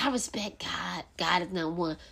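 mocking hypocrite. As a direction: mutter it like someone faking piety. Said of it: "So people like".

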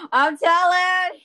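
loud, high, drawn-out tattletale cry; mock tattling. Guest threatening to tell on host, mock-outraged. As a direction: call it out like an outraged little tattler.

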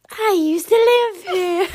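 high, loud, slow ghostly moan; eerie drawn-out moan through laughter. Retold or imitated. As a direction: moan it like a spirit. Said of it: "a bit like moaning".

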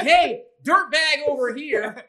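a hammed-up teacher singling him out; mock call-out.

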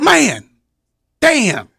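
high, fast anxious whine; worried A J. Retold or imitated. Wrestler imitating the next act panicking about following them. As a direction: rattle it off in a panic. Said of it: "He's like".

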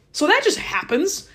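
ordinary talk, nothing marked

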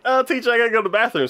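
high, rushed pupil's plea; imitated schoolgirl excuse. Girl asking teacher for the bathroom, hurried imitation.

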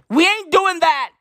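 emphatic refusal. Pretend. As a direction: put your foot down loudly.

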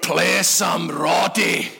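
put-on slurred old man, drawn out very slowly; drunk old man. Old guy repeating his request to the band, slow drunken voice. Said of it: "had one or two too many milkshakes".

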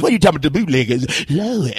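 ordinary talk

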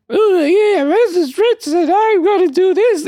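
high squeaky mock voice, slowed at the end; Elmo-like high voice. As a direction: mimic her in a silly high cartoon voice. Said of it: "You got me talking like Elmo".

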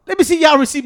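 high, loud mock challenge; retold loud demand. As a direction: call it out like a receipt check. Acts out sisters demanding the receipt.

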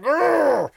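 high, loud mock angry growl; a cartoonish snarl of outrage. Mocking offended people with a high loud growl.

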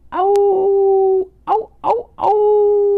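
loud, high, drawn-out howl; long howl, two short yips, long howl; wolf howl. Acted. Storyteller howling before saying good night, high wolf howl. Storyteller howls after the breaths.

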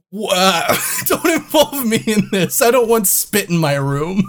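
high, sputtering retold protest; flustered protest. Guest refusing a stranger's quarrel at check-in, flustered protest.

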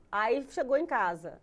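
usual speaking voice

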